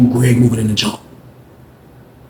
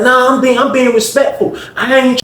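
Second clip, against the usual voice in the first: much higher, louder, earnest protest; Greg's high insistent voice; 11 semitones higher, 3 dB louder than usual. Retold or imitated. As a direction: insist on it in a high, hurt squeal. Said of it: "He like".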